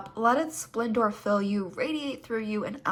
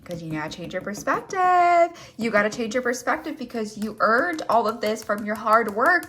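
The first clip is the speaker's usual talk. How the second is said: ordinary talk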